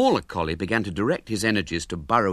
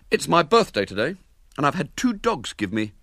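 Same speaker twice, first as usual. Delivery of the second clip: higher, proud schoolboy; a hint of a proud boy; boasting boy; 6 semitones higher, 3 dB louder than usual. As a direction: show off to the girl. William boasts to new partner.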